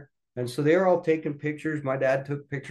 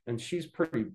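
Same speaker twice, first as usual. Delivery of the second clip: ordinary talk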